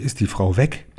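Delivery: plain reading voice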